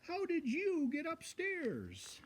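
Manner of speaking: slow, high, gentle watchman question. A narrator's reading. Watchman finding a bear in bed, slow and gentle. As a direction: coax the little bear out kindly. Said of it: "he said".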